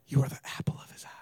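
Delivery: whispered, quick sweet nothing; hushed preaching. Pretend. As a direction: whisper it low and fast. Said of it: "Next week I'll preach on".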